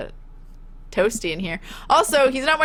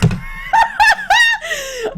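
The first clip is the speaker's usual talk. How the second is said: high, shrieking burst of laughter; 4 dB louder than usual. Real, not acted. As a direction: laugh out loud in disbelief. Shocked laughter after insult.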